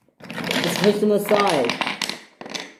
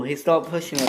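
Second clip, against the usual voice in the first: ordinary delivery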